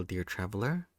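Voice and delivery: plain reading voice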